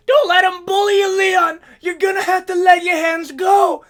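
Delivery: high-pitched, urgent corner yell; cornerman's shout. Retold or imitated. Head coach urging his fighter between rounds, shouting high. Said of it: "his head coach, his cornerman".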